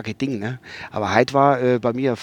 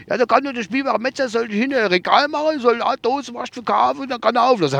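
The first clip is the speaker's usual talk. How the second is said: much higher driver voice; driver's voice; 9 semitones higher than usual. Retold or imitated. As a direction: quote the driver, pitched up. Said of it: "Dann habe ich gesagt".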